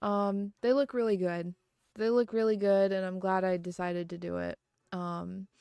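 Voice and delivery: ordinary delivery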